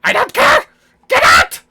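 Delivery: very loud, high-pitched yell; furious shouting. Acted. Gnome shopkeeper throwing customers out, shouting furiously. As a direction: explode as the outraged shopkeeper. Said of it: "He just keeps shouting".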